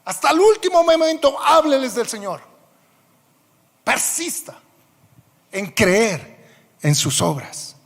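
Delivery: sermón a plena voz, más agudo y fuerte; predicación. Acted. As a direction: clama a la congregación con fervor. Predica con toda fuerza. (predicador clamando con fervor a la congregación)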